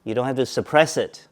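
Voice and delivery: usual speaking voice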